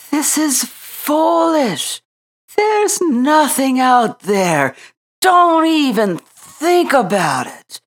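high, slow, weary bird voices; exhausted little birds. A narrator's reading. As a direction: squeak it out like spent little birds. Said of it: "they fell on the floor exhausted".

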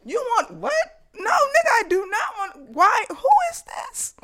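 very high, loud incredulous protest; hammed-up outrage. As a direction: shriek your disbelief as the jilted girlfriend. Girlfriend outraged at invitation with rival.

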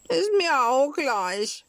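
put-on high, drawn-out childish defiance; defiant wail. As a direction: wail it slowly, refusing to care. Sulky puppet defies warning of death. (trotzig, hoch und gedehnt gejammert)

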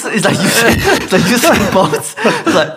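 high and loud, laughter running through it; laughing. Real, not acted. Retelling the file reveal to the host, laughing.

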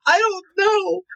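high, tearful voice; high, weepy voice of a performer moved to tears; crying. Retold or imitated. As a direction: squeeze it out through sobs. Retells his own crying reply.

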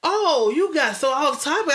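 ordinary delivery

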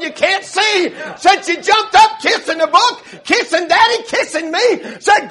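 high, loud revival storytelling; full-force preaching. Retold or imitated. Preacher retells Mama jumping up converted, full-force preaching. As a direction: punch out each phrase at preaching pitch.

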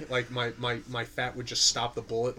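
ordinary delivery

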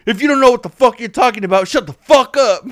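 high, loud and fast tirade; imitated road-rage lecture; retold angry rant. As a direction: rattle it off like a furious driver. Quoting Rich's rant, same driver.

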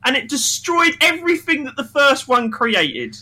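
high, loud worked-up complaint; a fan venting, high and loud; heated rant. Real, not acted. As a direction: pour out the outrage. Ranting about sequel.

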